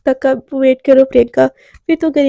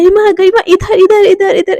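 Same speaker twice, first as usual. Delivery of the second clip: eager call across a busy canteen, higher and louder; 6 semitones higher, 3 dB louder than usual. Acted. Anaya waving Garima over to her table, calling out. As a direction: shout her friend's name to grab attention.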